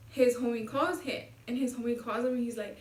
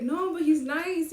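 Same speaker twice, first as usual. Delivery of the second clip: higher, louder, drawn-out defence; acted protest; 5 semitones higher, 6 dB louder, slower than usual. She defends her date to her homie, drawn-out protest. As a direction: plead his case, stretching the words.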